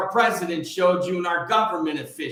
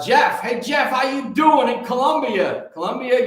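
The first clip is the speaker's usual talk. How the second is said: raised, high-pitched greeting to a friend abroad; hearty shout-out; 7 semitones higher, 4 dB louder than usual. Real, not acted. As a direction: call out to him, high and lively. Calls out greeting to Jeff abroad.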